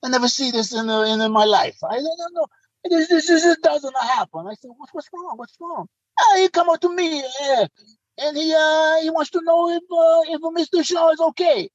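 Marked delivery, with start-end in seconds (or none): 0.0-4.5 put-on high-pitched Italian-accented English; flustered Italian accent, pitched high; Italian friend. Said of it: "he comes back, and he says". 4.8-5.9 hushed, high-pitched retold worry; mock-anxious soft question; worried asking. He acts out his worried question. 6.2-11.7 put-on high-pitched Italian-accented English; imitated excitable Italian friend. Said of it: "he comes back, and he says".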